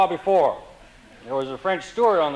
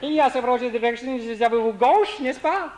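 lightly voiced Frenchman; 6 semitones higher than usual. Real Frenchman gives directions.

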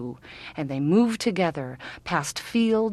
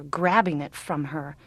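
normal talking voice

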